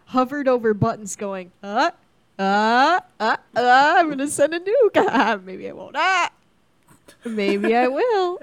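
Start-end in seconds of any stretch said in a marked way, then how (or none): 1.6-8.4 dithering. Pretend. Nations dithering over nuke buttons.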